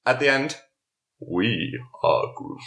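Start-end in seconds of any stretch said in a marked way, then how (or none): 1.3-2.6 deep, slow Groot rumble; slow low tree creature voice. Retold or imitated. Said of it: "they could have got you to do it".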